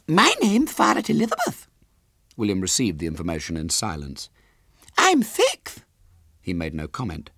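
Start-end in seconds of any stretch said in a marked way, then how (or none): 0.1-1.7 put-on very high little girl voice; squeaky small child, louder; Violet Elizabeth. A narrator's reading. Small girl questions her visitor, high and childish. 5.0-5.8 put-on very high little girl voice; squeaky small child, louder; Violet Elizabeth. A narrator's reading. Small girl questions her visitor, high and childish.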